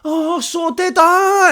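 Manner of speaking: very high, loud, slow squeal of delight; squealing drunk Scottish spaceman; delighted astronaut. Pretend. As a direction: stretch it out in shrill mock glee. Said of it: "the other one's like that".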